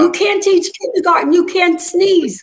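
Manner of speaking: mock desperate plea. Retold pleading to principal.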